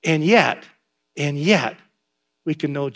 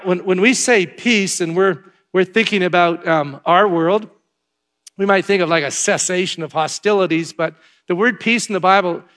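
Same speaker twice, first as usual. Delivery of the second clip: ordinary delivery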